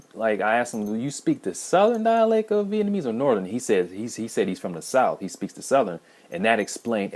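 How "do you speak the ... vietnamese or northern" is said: high-pitched quoted question; raised voice for a question put to a friend; retold question. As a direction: start high as you quote your own question.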